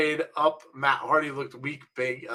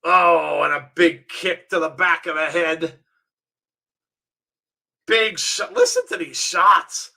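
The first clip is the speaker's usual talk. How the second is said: wrestling commentator; 3 semitones higher, 4 dB louder than usual. Acted. Calls the wrestling match live.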